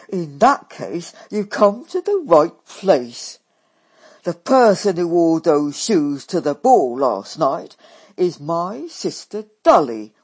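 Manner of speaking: loud, blunt working-class sister; loud brash Daisy. A narrator's reading. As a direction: bellow it out brashly. Daisy voiced loudly.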